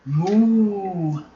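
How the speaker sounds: a long moo; cow moo. Acted. The cow mooing hello to the pig.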